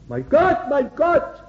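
high, forsaken call to God; impassioned double outcry; anguished cry. Acted. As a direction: cry out in acted agony. Acts out Jesus' cry on cross.

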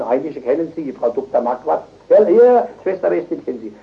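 ordinary talk, nothing marked

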